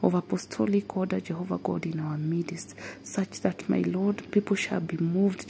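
usual speaking voice

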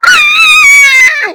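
very high, loud scream; panicked scream. Real, not acted. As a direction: shriek as the killer strikes. Killed or chased in game. (tiz panik çığlığı)

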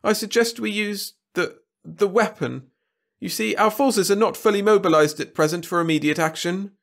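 high, anxious courtier voice; Wirt's whiny voice. A narrator's reading. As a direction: plead nervously with the king. Wirt voiced.

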